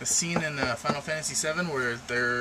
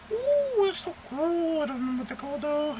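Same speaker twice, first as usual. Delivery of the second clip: very high put-on squeal of awe; gushing imitation. Vlogger imitating awesome game songs, high gushing voice. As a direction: coo it way up high, hammed-up. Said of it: "songs that are just like".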